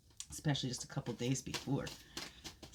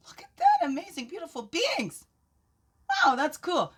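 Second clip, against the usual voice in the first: very high squeal of wonder, louder; delighted; 11 semitones higher, 7 dB louder than usual. Real, not acted. Showing viewers the drawn cards, delighted squeal. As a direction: gush over the cards.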